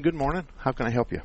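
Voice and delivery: usual speaking voice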